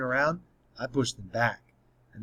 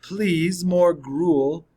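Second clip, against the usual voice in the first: a light, pitched-up beggar's plea for more; lightly voiced pleading orphan, playful; mock Oliver Twist plea; 6 semitones higher, 7 dB louder, much slower than usual. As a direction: ask for more like a hammed-up orphan. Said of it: "he always says".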